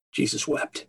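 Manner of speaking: breathy hushed whisper, full of grief. Acted. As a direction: whisper it as if at the graveside. Preacher repeats the verse hushed.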